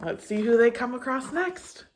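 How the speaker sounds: normal talking voice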